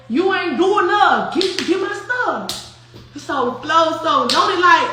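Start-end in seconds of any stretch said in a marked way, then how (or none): 0.1-2.5 put-on Saint Louis accent. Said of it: "them Saint Louis people, that little R".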